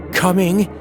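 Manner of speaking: ordinary delivery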